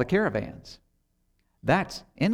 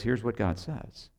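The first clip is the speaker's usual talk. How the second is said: grave, much softer, sinking low at the end; deadly serious; 3 semitones lower, 3 dB quieter than usual. Retold or imitated. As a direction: deliver the bad news without a smile. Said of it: "He gets really serious".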